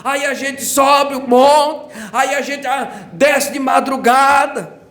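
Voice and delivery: impassioned revival sermon; fervent preaching. Acted. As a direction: preach it up with rising fervour. Preaching builds to full force.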